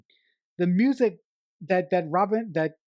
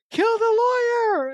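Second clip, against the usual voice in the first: very high-pitched imitated war cry; rally the gang with a high, playful yell; hammed-up call to attack; mock battle cry; 4 dB louder, faster than usual. Acts out Rufio's battle cry.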